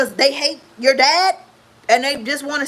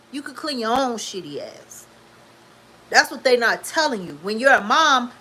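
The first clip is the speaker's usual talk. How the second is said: ordinary talk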